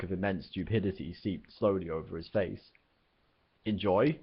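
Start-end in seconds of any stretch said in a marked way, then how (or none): none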